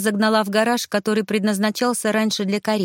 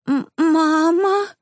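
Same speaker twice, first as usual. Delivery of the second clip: high, slow, startled squeak of fright; frightened squeak; 10 semitones higher, 4 dB louder, much slower than usual. A narrator's reading. Girl seeing a ghost squeaks in fright. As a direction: squeak it out in sudden terror. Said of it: "пискнула я".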